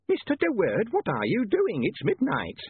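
high-pitched indignant landlady; affronted landlady. A narrator's reading. Landlady catching her lodger in the kitchen, affronted. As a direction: scold him from high in the voice.

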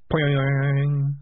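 long, ringing boing; imitated spring twang. A narrator's reading. Narrator as the clockwork rat breaks, drawn-out twang. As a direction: draw out the sound of a spring.

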